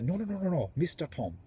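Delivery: put-on insulted refusal in a rough Indian accent; amateur Indian-accent impression; imitated Indian accent. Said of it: "That's my version of his accent".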